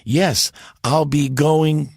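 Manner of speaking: slow, with the G of going held back and delayed; delayed hard G. Acted. Comedy sketch son answering father, delayed G. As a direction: drag it out, G arriving late. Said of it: "And he goes".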